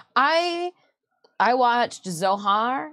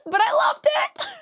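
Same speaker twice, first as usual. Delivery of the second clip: very high, laughing squeal; laughing delight. Real, not acted. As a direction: let delight break through in real laughter. Delighted laughter ending the story.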